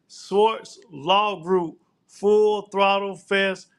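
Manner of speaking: drawn out, each name spelled out slowly; slow sponsor call-out. Real, not acted. Host reading sponsors to listeners, slow and emphatic. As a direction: read the sponsor names slowly, like a promo.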